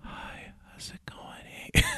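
slow, breathy ASMR whisper. Retold or imitated. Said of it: "whisper to you".

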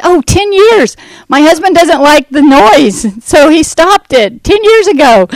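hostess explaining. Retold or imitated. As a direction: play the hostess casually explaining. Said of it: "She said".

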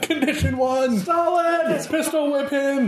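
high shouted raid orders; battle shouts. Pretend.

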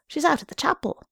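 put-on high-pitched child's reply; little boy. A narrator's reading. Son telling his father where mother is, childlike. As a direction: answer Pa as a small boy. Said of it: "A little boy came running down".

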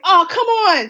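high-pitched exasperated reader; retold protest. Reader reacting when the little girl died, high retold protest.

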